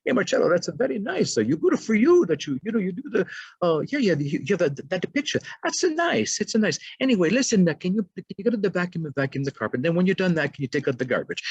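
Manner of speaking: high-pitched, chattering Italian mamma; Italian accent. Retold or imitated. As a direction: put on an Italian mother's accent, pitched right up. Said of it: "in her Italian accent".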